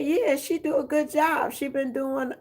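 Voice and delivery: high put-on voice of the funeral lady; funeral lady quoted. Aunt retelling what the funeral lady told them, high put-on voice. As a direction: lift the voice high for her.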